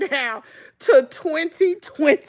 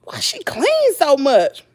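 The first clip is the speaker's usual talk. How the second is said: puzzled; 4 semitones higher, slower than usual. Retold or imitated. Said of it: "I'm like".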